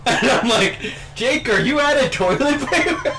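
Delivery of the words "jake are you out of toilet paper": high-pitched questioning call; mock calling out. Friend retelling calling to Jake in the bathroom, high call. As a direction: call it through a door, rising at the end.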